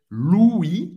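Louis as lwee, S silent; Louis, rounded French U gliding into I; Louis sem pronunciar o S. Shown as a demonstration. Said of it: "o U em francês tem um som do U".

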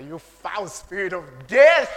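slow rebuke building to a loud final word; acted rebuke. Preacher retelling how he rebuked a spirit, forceful rebuke. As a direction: draw it out and punch the last word.

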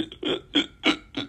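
a run of short, voiceless sob catches; stuttering mock sobs in the throat; imitated choked sobbing. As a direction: mimic crying too hard to speak. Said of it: "I couldn't get words out 'cause I was just".

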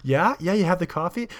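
mock-impressed questioning; egging her on. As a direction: imitate a guy eagerly egging someone on. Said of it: "egging her on".

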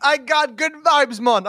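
put-on half-hearted Jamaican accent; failed Jamaican accent. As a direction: try a Jamaican lilt and miss. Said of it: "I can't do a Jamaican accent".

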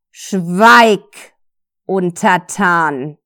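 loud, imperious goddess shout, slowed on the last word; commanding yell, dragging out Untertan. Acted. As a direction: bark it down at a lowly servant. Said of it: "schrie die andere weibliche Stimme".

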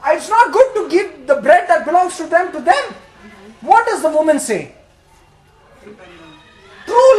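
loud, high fervent preaching; preaching at full force. Acted. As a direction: preach it out to the congregation.

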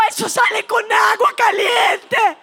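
loud, high acted yell; defiant shout. Girl fleeing the waxer, defiant panicked shout. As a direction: shout it back in comic panic.

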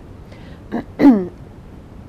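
a short, husky throat clearing. Real, not acted. Reader pauses mid-reading.

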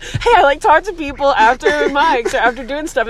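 mock podcast host. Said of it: "podcast that's just like".

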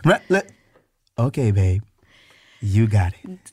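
low, soothing giving in; calm surrender. Shown as a demonstration. Husband telling men how to calm an angry wife, soothing. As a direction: defuse her with a low, easy yes. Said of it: "You say".

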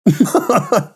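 high chuckle; short amused laugh at a teasing compliment. Real, not acted.

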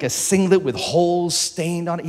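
ordinary talk, nothing marked

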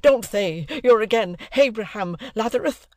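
high, lisping wail of dismay; excited cry. Acted. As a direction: plead in a high lisp. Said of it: "cried my excitable acquaintance".

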